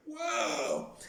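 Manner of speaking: amazed whoa. Acted. Amazed after rhythm clap.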